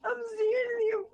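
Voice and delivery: high squeal, slowed by laughter; laughing while talking. Real, not acted. Storyteller carrying on after a laughing fit, still laughing. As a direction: struggle on through the giggles.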